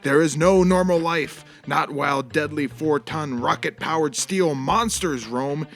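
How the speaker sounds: deep, dramatic trailer narration; movie trailer voice. Acted. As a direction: sell it like an epic film trailer. Movie trailer intro parody.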